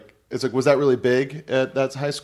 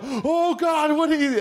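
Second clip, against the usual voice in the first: much higher, hammed-up gasp of alarm; mock shocked; slower than usual. Comic onstage shock at the blackface dummy, hammed-up. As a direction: play up fake horror at the dummy. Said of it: "And then I go".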